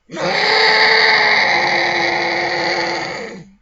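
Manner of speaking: long, high, very loud dinosaur roar; T-Rex roar. Shown as a demonstration. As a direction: let out a huge T-Rex roar. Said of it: "I will do a dinosaur impression".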